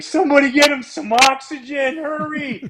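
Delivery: high, urgent mock alarm; mock panic. Teammates panicking over their winded manager, high mock alarm. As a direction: call for help in a hammed-up panic. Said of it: "We're like".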